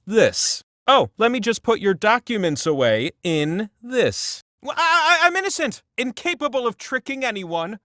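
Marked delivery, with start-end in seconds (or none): 4.6-7.8 high, stammering fake innocence; mock innocent. Host pretending innocence to the players, high and stammering. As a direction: protest your innocence a bit too much.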